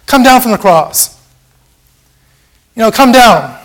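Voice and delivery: high, loud jeering cry; taunting crowd yell; mocking shout. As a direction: jeer at him like the mob at the cross. Preacher voicing mocking crowd.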